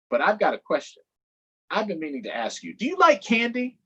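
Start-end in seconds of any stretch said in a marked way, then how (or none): 2.7-3.8 high, eager cartoon donkey; perky donkey pitching for votes. Donkey asking kids for votes, high and eager.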